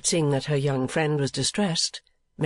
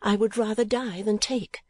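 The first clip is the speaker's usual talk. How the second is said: with energy; 7 semitones higher than usual. A narrator's reading. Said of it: "said Kate with energy".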